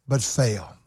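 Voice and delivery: sudden low, soft "but fail"; dropped low. Real, not acted. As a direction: drop the voice hard for the punchline. Sermon climax drops to punchline.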